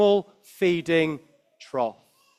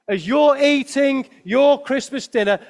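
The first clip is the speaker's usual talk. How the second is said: usual speaking voice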